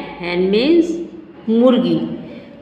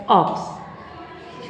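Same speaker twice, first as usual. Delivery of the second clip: whispered word; 3 semitones lower, 6 dB quieter, much slower than usual. Shown as a demonstration. Teacher repeats word softly.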